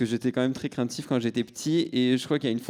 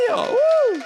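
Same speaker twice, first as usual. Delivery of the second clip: crowd cheer; 7 dB louder than usual. Pretend. Guest mimics a cheering crowd.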